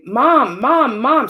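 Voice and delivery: a mother suddenly crying out for her daughter; calling out. Retold or imitated. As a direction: call out urgently across the room.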